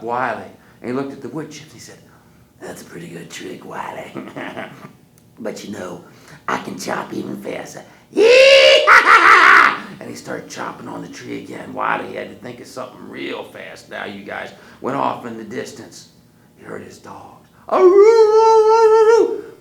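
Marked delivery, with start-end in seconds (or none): 2.6-7.8 a hint of monster boast. Hairy man boasts. 8.1-10.0 loud, slow whooping cackle; wild whooping cackle. Acted. Hairy man going back to chopping, loud whooping cackle. 17.7-19.5 high loud baying howl; dog howl. Acted. Wiley's dogs howling far off, high baying.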